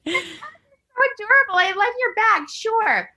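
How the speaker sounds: mock sweet voice. Mocking a fake sweet housewife.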